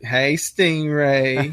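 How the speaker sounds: slow drawn-out "Hey, Stingray" with a rising end; wife's flirty greeting. Retold or imitated. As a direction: coo it slowly to the guest. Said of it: "she's like".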